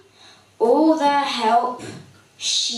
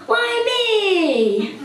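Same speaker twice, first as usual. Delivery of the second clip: surprised whine; 7 semitones higher than usual. Acted. Sophie finally caught out.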